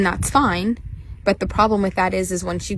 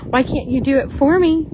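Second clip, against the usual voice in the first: higher, pleading mock whine; whiny teen; 7 semitones higher, 3 dB louder than usual. Spoiled horse begging mom to do it for him, whiny. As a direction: whine like a spoiled kid dodging chores. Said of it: "And he's like".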